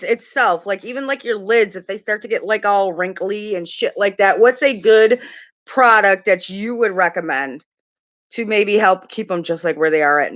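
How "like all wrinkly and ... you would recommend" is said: ordinary delivery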